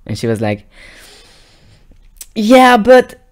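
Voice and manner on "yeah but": sudden high, loud yeah but; high loud admission. Retold or imitated. Girlfriend finally admitting it, high and loud. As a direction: blurt the confession.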